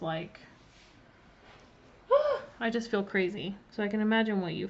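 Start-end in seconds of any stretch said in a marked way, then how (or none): 2.1-2.5 sharp gasp. Real, not acted. Packing stress, feeling crazy.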